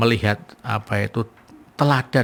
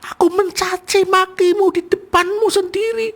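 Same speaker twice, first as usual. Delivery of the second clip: high, slow, weeping beggar's voice; acted tearful cry; slower than usual. Preacher voicing the beggar weeping over Muhammad, high and slow. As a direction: cry out in remorse, voice breaking. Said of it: "Nangis tuh, nangis".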